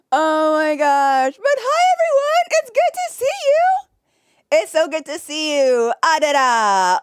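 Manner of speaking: high, loud, gushing hello; excited greeting. Real, not acted. Streamer greets her chat with a high, loud, excited hello.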